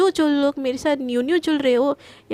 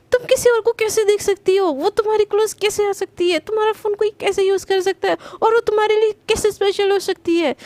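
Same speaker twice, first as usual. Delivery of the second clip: higher, louder jealous demanding questions; jealous outburst; 6 semitones higher, 4 dB louder than usual. Acted. Priyanka, jealous, demands answers from Garima, high and loud. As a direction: fire the questions in a hurt rage.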